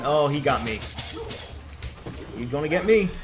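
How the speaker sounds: ordinary delivery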